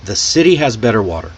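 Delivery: city, better, water with D-like Ts, quick and linked; smooth flap Ts. Shown as a demonstration. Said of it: "See how smooth that, that comes out".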